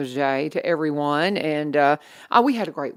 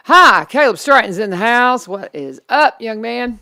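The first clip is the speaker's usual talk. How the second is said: booming on-air welcome; loud greeting; 5 semitones higher, 11 dB louder than usual. Real, not acted. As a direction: call out the guest's arrival. Host greets guest arriving.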